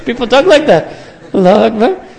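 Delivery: real laugh breaking through the words. Real, not acted. Preacher laughing at how stressed people talk.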